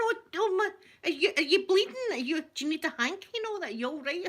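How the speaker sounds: high, flustered retold concern; alarmed fussing. Me and my mum to the bleeding lady, alarmed.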